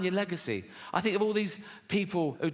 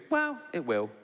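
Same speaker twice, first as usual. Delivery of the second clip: usual speaking voice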